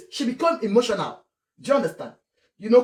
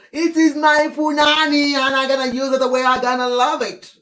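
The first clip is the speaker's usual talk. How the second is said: louder, sassy defiant voice; defiant mock wife; 3 semitones higher, 4 dB louder than usual.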